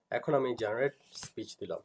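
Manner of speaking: normal talking voice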